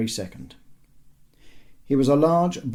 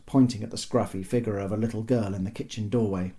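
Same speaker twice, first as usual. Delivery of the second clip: ordinary delivery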